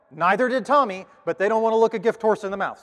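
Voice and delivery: ordinary delivery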